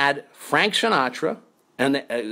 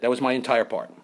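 ordinary talk

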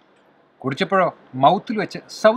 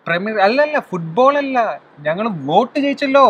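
excited correction; 7 semitones higher, 4 dB louder than usual. Real, not acted. Correcting her premier league guess.

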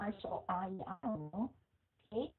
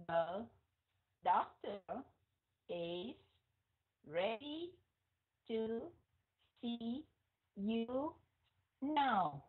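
slow word-by-word reading; much slower than usual. Shown as a demonstration. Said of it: "cố tình muốn các anh chị phải đọc chậm rãi".

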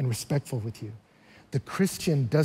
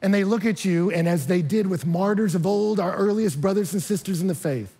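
ordinary talk

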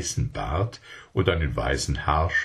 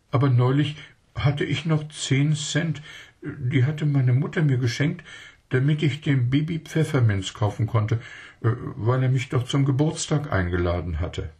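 put-on child voice; 7 semitones higher than usual. A narrator's reading. Zweselchen explains shyly.

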